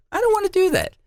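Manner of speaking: high-pitched indignant protest; mock protest. Husband refusing to his wife, high indignant protest. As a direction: yelp it like an outraged husband. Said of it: "I'm like".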